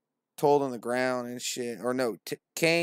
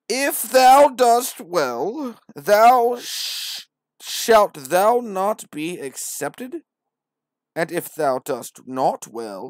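much higher, louder, very slow preacher voice; grand scripture recital; 5 semitones higher, 11 dB louder, slower than usual. Acted. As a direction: proclaim every word. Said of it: "Listen, for real though".

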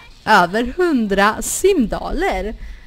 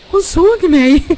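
startled surprise; 7 semitones higher, 10 dB louder than usual. Real, not acted. Character catches her watching.

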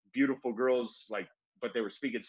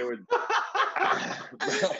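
laughing; 4 dB louder than usual. Real, not acted. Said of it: "laughing mid-story".

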